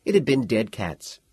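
plain reading voice

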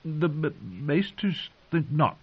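stammering. A narrator's reading. Sam answering his commander, nervous and stammering. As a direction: trip over the first word. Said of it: "Sam stammered".

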